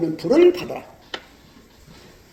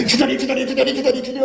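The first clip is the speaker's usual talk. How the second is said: raised-pitch imitation of endless babbling; mock rambling prayer; 5 semitones higher than usual. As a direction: rattle on in mock prayer chatter. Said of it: "얼마나 말을 많이 하는지".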